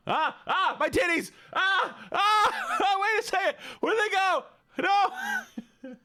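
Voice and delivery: very high shrieky yelps breaking into laughter; panicked squealing. Real, not acted. Streamer losing track of what they had, panicked squealing.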